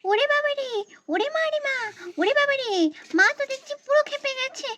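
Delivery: put-on high, fast little-kid chatter; child squealing in alarm; panicking child. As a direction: gabble in mock fright as you flee. Child panics, runs to hide.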